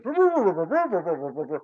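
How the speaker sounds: put-on wordless mumbling old man, jumping up then dropping; masked old guy. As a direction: imitate garbled talk through a mask. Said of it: "this old guy in there with a mask on".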